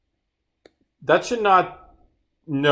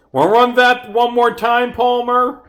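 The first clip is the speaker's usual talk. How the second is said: high, loud, disbelieving; loud mock disbelief; incredulous challenge; 12 semitones higher, 7 dB louder than usual. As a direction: call someone out on a slip, pitched way up. Mocking challenge to a misread line.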